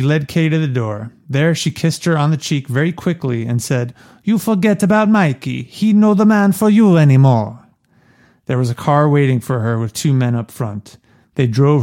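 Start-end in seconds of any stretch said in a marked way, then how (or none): 4.2-7.6 put-on higher, heavy Italian Mama Corleone accent; strong Italian accent. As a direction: read her lines as a thick Italian mother. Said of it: "very strong Mama Corleone accent".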